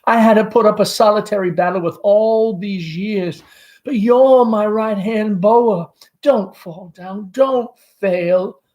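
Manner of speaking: high nervous Southern mother; anxious mother Amanda. Acted. Amanda voiced by reader.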